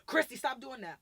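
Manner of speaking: put-on screaming at a kid. Shown as a demonstration. Adult screaming at a child, loud telling-off. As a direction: yell it as a sharp order. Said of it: "Why you screaming at me?".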